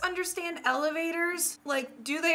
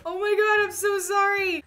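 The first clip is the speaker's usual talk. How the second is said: mock distress; 6 semitones higher, 4 dB louder than usual. Mock apology after insulting dream.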